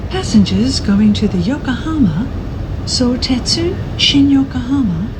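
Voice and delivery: ordinary talk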